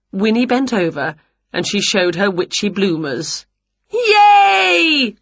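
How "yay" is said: put-on high, loud children's cheer; long high-pitched whoop. A narrator's reading. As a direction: cheer like a delighted crowd of children. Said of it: "cheered the children".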